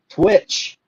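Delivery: ordinary talk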